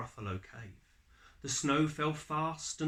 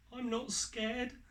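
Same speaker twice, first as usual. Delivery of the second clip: put-on high, defiant little-child voice; child boasting in the dark wood; brave child; 11 semitones higher than usual. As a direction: insist on it like a small kid being brave.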